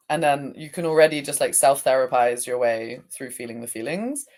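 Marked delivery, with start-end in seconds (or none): none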